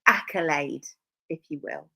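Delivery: ordinary talk, nothing marked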